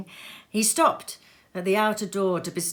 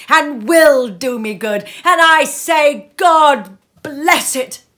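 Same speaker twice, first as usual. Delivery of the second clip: passionate; 7 semitones higher, 12 dB louder than usual. Acted. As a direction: rise to the climax and bless it. Nephew's impassioned defence of Christmas.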